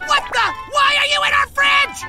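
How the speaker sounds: high, loud outraged shout; startled, indignant shriek; shocked yell. Acted. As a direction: yell at an intruder, voice shooting up. Finds boss in their fridge.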